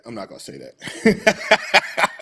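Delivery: slow teasing refusal. Shown as a demonstration. To viewers, a slow teasing refusal to finish. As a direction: stretch "I'm not gonna say that" out playfully.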